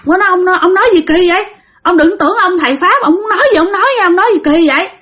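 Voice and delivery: high-pitched, repetitive retold scolding; angry cursing. Great-grandfather rebukes the master for strange words, angry. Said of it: "ông Cố chửi quá trời luôn".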